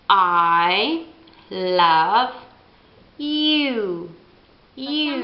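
I love you spoken as a model; example phrase. Shown as a demonstration. Teacher repeating I love you example. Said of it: "si pronuncia così".

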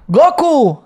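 high cartoon call; Spain dub voice. Showing how the Spanish dub sounds, high anime call. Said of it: "Así, güey".

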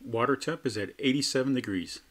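ordinary talk

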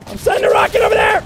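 fast, high, loud rocket callout; shouted callout. Real, not acted. Gamer warning team before firing rocket, fast shout.

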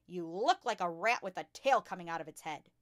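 loud, insulting shout. A narrator's reading. Headmistress mocks a pupil's pigtails, shouting. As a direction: shout it right at the child. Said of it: "at the child's head and shouted".

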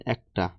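একটা said as "aekta", with an open first vowel; open ae sound. Shown as a demonstration. Teacher giving students an example of the ae vowel, একটা as "aekta". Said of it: "এ বর্ণের অ্যা এর উচ্চারণ".

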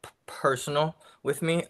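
real stutter on t and p; blocking on first sounds. Real, not acted. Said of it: "I have a stutter".

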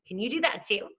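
usual speaking voice

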